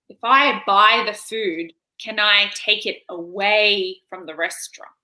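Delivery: very slow, deliberate, word by word; imitating painstakingly patient clarification; slow explaining. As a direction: spell it out for someone who doesn't understand. Spelling out takeaway to baffled server.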